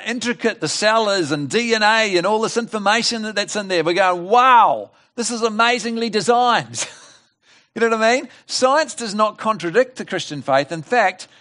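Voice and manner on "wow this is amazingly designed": loud, high wow of wonder; acted amazement. Preacher voicing believers marvelling at the cell, loud amazed wonder. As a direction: marvel aloud, pitch lifted, opening word punched.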